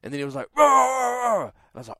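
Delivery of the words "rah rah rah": high, loud imitated growling noise; mock growl. Intruder at the window answering him, imitated loud growling. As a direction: growl back like the stranger did.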